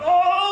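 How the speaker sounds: loud, high wail of pain; doubled-over groan. Acted. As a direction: howl as if doubled over with cramps. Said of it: "doubled over like this".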